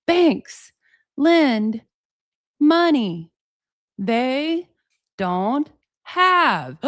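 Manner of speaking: word by word, big pauses; mock him spelling it out very slowly; slow mocking imitation. Host mocking a banker explaining basic banking, painfully slow. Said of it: "explain it so slow, like".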